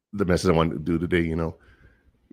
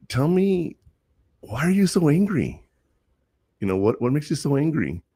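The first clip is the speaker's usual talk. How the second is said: gentle counselor voice; 9 semitones higher than usual. Retold or imitated. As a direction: ask it kindly, leaning in. Counselor gently questioning an angry teen.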